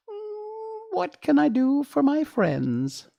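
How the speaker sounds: tiny mouse queen, very high and squeaky; squeaky little voice. A narrator's reading. Mouse queen greeting Dorothy's friends in a squeaky voice. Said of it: "in her squeaky little voice".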